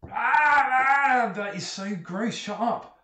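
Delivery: high, loud cry of disgust; grossed-out jailer squealing; disgusted sailor. Acted. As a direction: wail in revulsion, then protest. Jailer recoils from the bucket.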